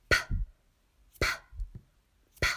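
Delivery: P as bare lip pops, no vowel; isolated consonant sound. Shown as a demonstration. Teacher tells children which sound to find, P popped alone. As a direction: pop each P alone with long pauses. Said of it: "le son".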